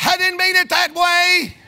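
high, loud protest to the congregation; defensive outburst. Real, not acted. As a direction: cry out in self-defence. Defends himself to reacting congregation.

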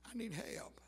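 barely voiced whisper for help; whispered plea. Sinner crying out to God, whispered plea. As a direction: let the plea sink into a whisper.

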